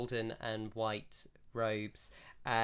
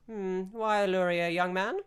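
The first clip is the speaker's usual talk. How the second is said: put-on high, louder elderly lady asking; a curious old priestess; 8 semitones higher, 6 dB louder than usual. Priestess questions the player.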